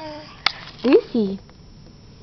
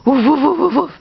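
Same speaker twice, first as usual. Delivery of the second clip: higher, louder excited barks; bigger woof; 4 semitones higher, 5 dB louder, faster than usual. Shown as a demonstration. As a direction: push the woofs up and out. Repeats dog sound bigger.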